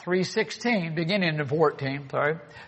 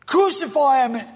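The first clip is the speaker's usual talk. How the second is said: louder, high mob cry; crowd shout; 7 semitones higher, 5 dB louder than usual. Acted. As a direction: yell it like a worked-up mob. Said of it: "they got more worked up".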